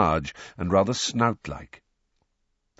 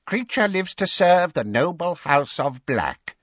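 servile house elf; 10 semitones higher, 4 dB louder than usual. A narrator's reading. Elf's servile pride.

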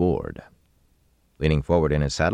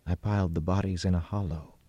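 subdued, softer delivery; flat voice; 3 semitones higher, 6 dB quieter than usual. A narrator's reading. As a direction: report it flatly and quietly. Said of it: "he said in a flat voice".

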